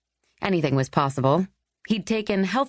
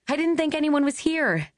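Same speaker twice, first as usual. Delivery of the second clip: put-on young woman voice; 10 semitones higher than usual.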